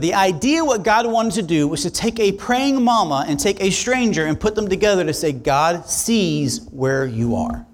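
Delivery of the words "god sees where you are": tender. Acted. Stranger telling a praying mother God sees her, slow tender. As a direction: say it slowly, gently, like a promise.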